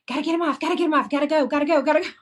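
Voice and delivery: high, loud, rapid-fire panic; frantic urging. Retold or imitated. Angler rushing to unhook a fish, frantic. Acting out own frantic rush.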